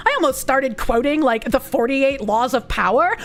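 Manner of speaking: put-on melodramatic Valley girl with swooping pitch; hammed-up, traumatized Valley girl. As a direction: play a Valley girl recounting a horror story. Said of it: "replicate Kirsch's Valley Girl voice".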